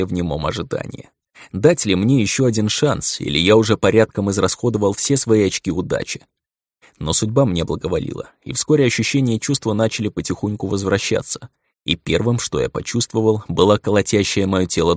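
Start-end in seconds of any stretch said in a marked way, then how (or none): none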